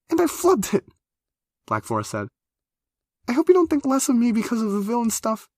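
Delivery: put-on very high, loud pouting girl; high whiny complaint. A narrator's reading. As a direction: whine it out, wounded. Embarrassed apology.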